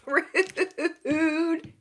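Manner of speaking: high word broken up by laughter; giggly, high-pitched mock offense; laughing. Real, not acted. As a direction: laugh right through the drawn-out word. Laughing at her blunt message.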